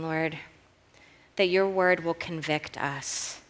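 soft, hushed praying, fading toward the amen; prayer. Real, not acted. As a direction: speak softly and reverently to God. Leads congregational prayer.

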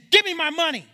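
high, loud shout; angry demand. Acted. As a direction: demand it like you have him by the throat. Servant grabs debtor by the throat.

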